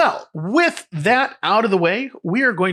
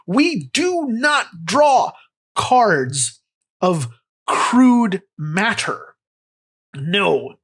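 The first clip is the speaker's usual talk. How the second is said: slow, booming mock proclamation; mock grandeur; 2 semitones higher, much slower than usual. Host rejects paper cards, grand mock proclamation. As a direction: proclaim it like a pompous herald.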